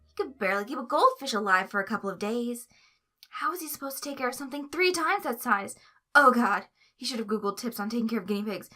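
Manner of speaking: ordinary delivery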